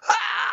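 piercing high scream of pain on the toilet. Retold or imitated. Retelling surgery pain to the radio audience, a piercing mock scream. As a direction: let out a shrill yelp of agony. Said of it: "and I was like".

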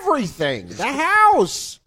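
high put-on voice of a rich father; mock rich dad voice. Friends riffing on a video, mock rich father voice. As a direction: play the entitled old rich man. Reacting to music video clip, mocking.

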